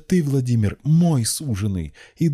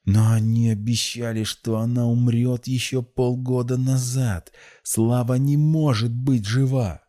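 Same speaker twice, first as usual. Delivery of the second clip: high-pitched, malicious protest; slow, high-pitched angry disbelief; spiteful; 7 semitones higher, 4 dB louder, slower than usual. A narrator's reading. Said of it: "зло прошипела одними губами".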